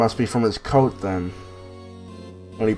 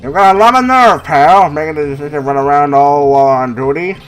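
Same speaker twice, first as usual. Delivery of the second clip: gruff detective voice. Acted. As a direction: bark it like a tough cop. Voicing the detective.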